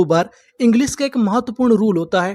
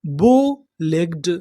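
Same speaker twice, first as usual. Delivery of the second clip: बो legged said very slowly and lower; slow sounding out; 5 semitones lower, much slower than usual. Shown as a demonstration. Teacher to students learning English, slow lower demo. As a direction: stretch बो legged out, each part held. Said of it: "तो हमारी पूरी meaning क्या बनी".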